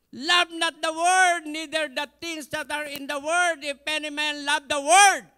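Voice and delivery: fiery preacher quoting the Bible; preaching at full force. Acted. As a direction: thunder the verse out. Quoting scripture in sermon.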